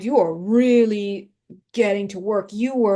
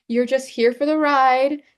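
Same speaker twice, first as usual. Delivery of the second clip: usual speaking voice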